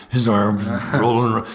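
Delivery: ordinary delivery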